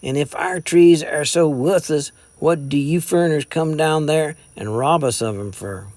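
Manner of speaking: mildly bitter mountain scorn; bitter sneer. A narrator's reading. Jason accuses the foreigners of robbing them, bitter. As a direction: spit out the accusation. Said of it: "The sneer, the tone, and the bitter emphasis".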